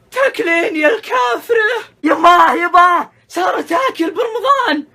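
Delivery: shrill crying yell to the parents; tattletale shout. Acted. Child catches someone eating in Ramadan, crying shout. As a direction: scream it out to tell on her.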